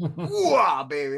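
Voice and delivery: lighter gung-ho soldier shout; a hint of a fired-up army colonel; Al Pacino. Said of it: "I thought you were impersonating Al Pacino".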